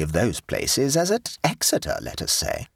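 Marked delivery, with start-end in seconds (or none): none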